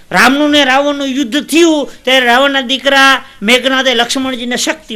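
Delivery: high, loud battle narration; declaimed epic storytelling. Acted. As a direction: proclaim the war like a folk bard. Launches epic tale in declaiming voice. (ઊંચા બુલંદ અવાજે યુદ્ધકથા)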